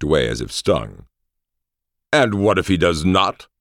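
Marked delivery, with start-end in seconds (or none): 2.1-3.5 high, indignant demand; play-acted indignation, pitch raised; mock outrage. A narrator's reading. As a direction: demand it as if deeply affronted. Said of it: "demanded in mock outrage".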